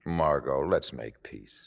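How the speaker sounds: low, softened plea for peace; tender appeal. Acted. Boyfriend asking to end the quarrel, tender appeal. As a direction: say it gently, voice sinking.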